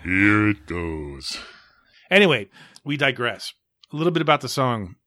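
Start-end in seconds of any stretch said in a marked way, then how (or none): 0.0-1.4 slow, artificially deep drawl; really deep voice. Shown as a demonstration. Podcast host showing off how deep the voice goes, really deep and slow. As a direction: drop way down low and stretch the words out. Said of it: "hear my voice go really deep".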